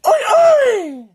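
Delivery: very high, loud whooping holler; Indian-style war call. Retold or imitated. Showing his own call for trouble, loud high Indian-style whoop. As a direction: whoop it out for the whole neighborhood. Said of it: "I did like an Indian".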